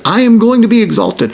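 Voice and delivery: God's bold declaration of glory; emphatic declaration. Retold or imitated. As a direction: proclaim it as God's own promise. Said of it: "God says".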